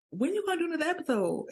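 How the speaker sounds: high, fast imitated question; quick, high-pitched nudge; eager asking. Voicing cousin asking about podcast.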